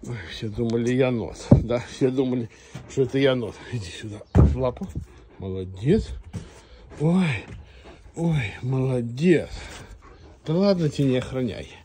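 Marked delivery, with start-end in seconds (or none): none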